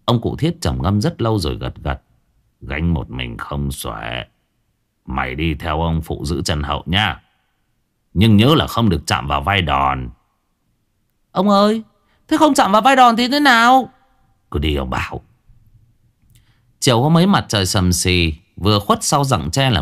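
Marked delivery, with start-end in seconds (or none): 2.6-10.1 put-on old man. A narrator's reading. Old Thiết answering the boy, low and grave. 11.3-13.9 put-on high, loud child voice; a curious boy asking high. A narrator's reading. Boy questioning his great-uncle, high childish voice. Boy asks the old man. 14.5-15.2 put-on low, softer old man's voice; a stern grandfather speaking low. A narrator's reading. Old Thiết telling the boy to go, low.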